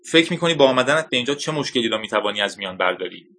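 ordinary delivery

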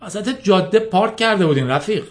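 mildly beseeching, defending himself; pleading. A narrator's reading. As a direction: plead your case to an angry man. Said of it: "با صدای التماسوار گفت".

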